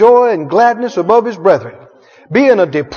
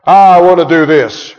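lower, stubborn flesh; 6 semitones lower than usual. Acted. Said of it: "the flesh says".

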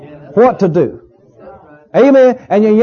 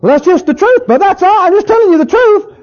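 hammed-up self-righteous voice; mocking self-righteous tone; 9 semitones higher than usual. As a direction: mimic a smug know-it-all. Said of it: "but can you tell it in love?".

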